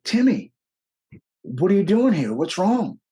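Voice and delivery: a father's startled worried cry; surprised older man. Retold or imitated. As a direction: call out in shock at the sight of him. Friend's father surprised to see him.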